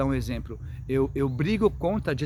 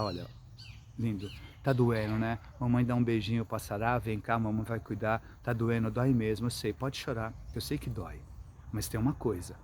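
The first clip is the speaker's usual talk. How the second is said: softer, lower, gentle mother's voice; calm and tender; 4 semitones lower, 5 dB quieter than usual. Shown as a demonstration. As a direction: soothe the hurt child lovingly. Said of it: "falar com calma, com carinho". (com calma e carinho, voz mais baixa e suave)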